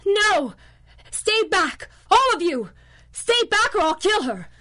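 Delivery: desperate screaming threats, high and loud; furious shouting. Acted. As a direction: yell to keep the agents back. Villain threatens hostage, shouting.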